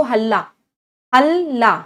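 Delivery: हल्ला said loud and punched, syllable by syllable; spelling emphasis. Shown as a demonstration. Teacher to students, a loud punched हल्ला. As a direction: hit हल्ला hard so the spelling sticks. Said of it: "इसको याद कैसे करोगे spelling को?".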